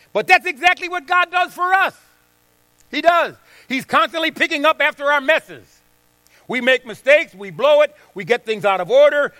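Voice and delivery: loud, high, driving preacher's cry; pulpit-pounding sermon peak; full-force preaching. Acted. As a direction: preach it hard to the congregation.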